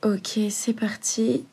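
soft unvoiced whisper. Real, not acted. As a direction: whisper it to yourself, slowly. Quiet start before recording.

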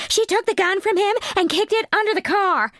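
put-on high, breathless voice of the chunky girl; breathless witness. The chunky girl telling the cop, high and breathless. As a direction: rush it out, breathless and eager. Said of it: "still talking breathlessly".

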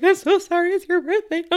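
mock sobbing through the words; retold crying apology. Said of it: "I burst out bawling".

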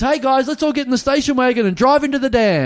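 high, loud, falsely cheery family announcement; divorced dad. Retold or imitated. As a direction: imitate an unhinged dad rallying the kids. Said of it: "I'm the divorced dad who".

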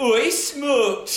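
hammed-up Geordie exclamation. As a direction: tease in a broad, loud Geordie lilt. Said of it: "Very Geordie".

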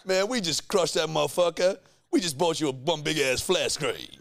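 lightly voiced showman bragging about flat screens; mock car show host. Mocking the car makeover host, a hint of a hyped presenter.

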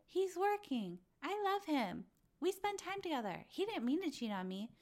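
put-on quoting Raquel defending her cheating boyfriend, high and soft; imitating Raquel. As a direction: play a naive girlfriend making excuses. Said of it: "also just like".

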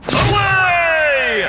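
high, loud superhero shout; heroic exit cry. Acted. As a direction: call it out as you fly off. Superhero flies off.

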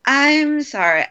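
fake apology. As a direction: toss off a hollow sorry. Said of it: "instead of".

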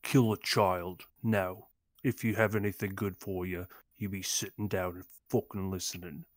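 little girl turns gangster, lightly voiced London menace; British gangster. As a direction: threaten like a Guy Ritchie thug, lightly voiced. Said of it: "this little girl is a Peaky Blinder?".

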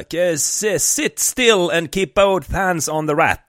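loud, slow, high police order in broken English; imitating a colleague yelling at a suspect; retold shouted command. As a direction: bark it out slowly like a nervous trainee cop. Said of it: "skrek han också".

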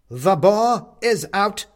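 the goblin king warns the captive, slow and booming; ominous goblin king. Acted. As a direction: proclaim the threat like a grim monarch.

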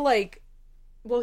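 usual speaking voice

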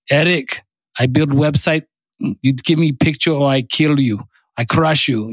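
mock threat in a put-on Russian accent; badly faked German that sounds Russian; fake Russian accent. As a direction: play the giant foreign friend with a hammy Russian accent. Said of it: "That was a fake Russian accent".

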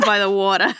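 put-on laughing Boston accent. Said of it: "We're trying to emulate it".

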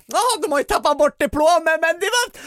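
very high, silly voice; re-enacted giddy high-pitched announcement; acting goofy. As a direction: squeak it out, clowning for the crowd. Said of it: "skulle toka mig".

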